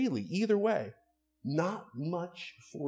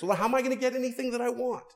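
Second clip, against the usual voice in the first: put-on much higher, fast, whining child voice; mock whiny child; 12 semitones higher, 5 dB louder, faster than usual. Child told to obey parents, in a much higher, fast mock whine. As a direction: squeak it out like a spoiled kid. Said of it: "Children, don't defy your parents".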